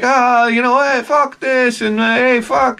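lightly hammed-up teen cursing; mocking kids cursing. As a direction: ape the rude skater kids.

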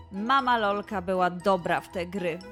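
ordinary delivery, nothing marked

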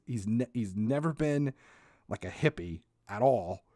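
usual speaking voice

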